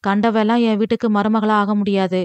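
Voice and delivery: plain reading voice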